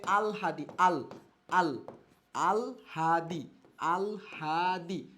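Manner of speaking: "আলহাদি" broken into slow, drawn-out syllables; pronunciation demo. Shown as a demonstration. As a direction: model "আলহাদি" as aal... haa... dee. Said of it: "এর উচ্চারণ হবে". ("আলহাদি" টেনে টেনে, ভেঙে ভেঙে উচ্চারণ)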